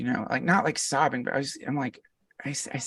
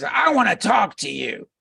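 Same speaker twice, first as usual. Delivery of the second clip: mock stern summons, pitched up and louder; fake fierce; 6 semitones higher, 4 dB louder than usual. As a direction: play the guru's pretend-fierce warning. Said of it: "he could do fake fierce really well".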